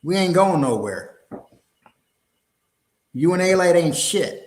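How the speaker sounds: ordinary talk, nothing marked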